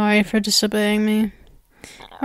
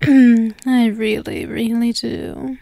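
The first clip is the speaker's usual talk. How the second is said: slow emphatic repeat; slower than usual. Acted. Spider captor savouring her captive's obedience, slow and emphatic. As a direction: linger over every word. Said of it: "I really do".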